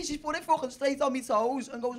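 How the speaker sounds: usual speaking voice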